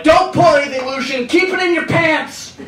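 a hint of a stern self-warning; stern pep talk. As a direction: scold yourself half-jokingly. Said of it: "give myself a little talking to".

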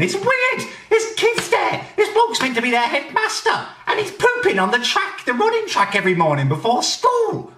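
high-pitched scandalised shriek; outraged squeal. Acted. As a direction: squeal it in comic disgust. Outraged rant about headmaster.

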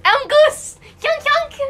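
high, loud honking goose. Pretend. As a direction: squawk it like a goose. Playing the goose.

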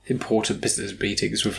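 ordinary talk, nothing marked

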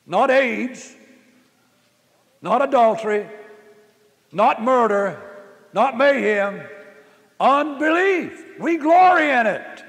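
rising pulpit crescendo; full-force preaching. Acted. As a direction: hammer each point home to the congregation.

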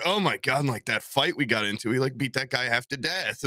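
usual speaking voice

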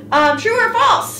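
high, slow game show host; quizmaster voice. Acted. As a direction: draw out true or false like a host. Quiz host asking question.

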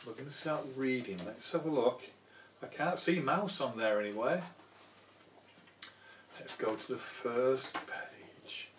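plain reading voice

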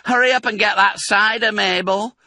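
high, loud call down the stairs; calling from upstairs. Acted. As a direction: shout it down to the cellar. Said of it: "the voice called".

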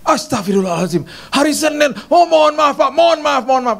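the maid realizes her mistake and panics; panicked maid. Retold or imitated. Maid realizes it's not Monday.

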